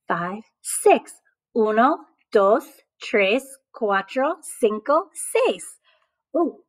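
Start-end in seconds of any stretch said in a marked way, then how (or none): 1.6-5.6 "Uno, dos, tres" counted in clear Spanish; counting in Spanish. Shown as a demonstration. Teacher counting zebras again in Spanish. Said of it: "that second time I did it in Spanish".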